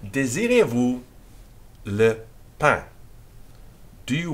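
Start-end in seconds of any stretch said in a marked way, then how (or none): 0.0-2.8 Désirez-vous le pain said in French, louder and with a questioning lift; French model phrase. Shown as a demonstration. Teacher models the French question for pupils, in careful French. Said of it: "Question mark".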